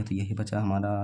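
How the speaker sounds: usual speaking voice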